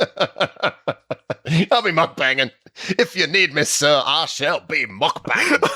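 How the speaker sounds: slow, hammed-up Southern cartoon gentleman; mock-grand Southern bluster, stretched out; Foghorn Leghorn. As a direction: drawl it pompously, on the edge of laughing. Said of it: "good in the Foghorn Leghorn accent".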